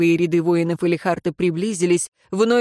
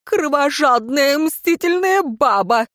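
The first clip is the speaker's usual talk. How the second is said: high, slow furious curse; angry cursing; 6 semitones higher, slower than usual. A narrator's reading. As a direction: spit the insult out. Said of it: "выругался он". (злобная ругань)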